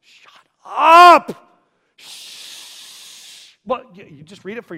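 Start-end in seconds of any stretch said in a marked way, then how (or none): 0.0-1.5 sharp high shout to silence him; angry crowd. Acted. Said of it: "What do crowds do when people start screaming". 2.0-3.6 hard unvoiced shush. Crowd shushing the noisy beggar, hissed. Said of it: "What do crowds do".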